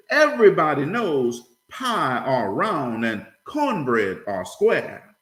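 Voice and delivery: slow, emphatic folksy correction; deliberate punchline. Acted. Grandfather corrects the boy's algebra, slow and emphatic. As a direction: land the punchline word by word.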